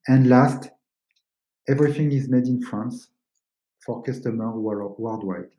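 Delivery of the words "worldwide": ordinary talk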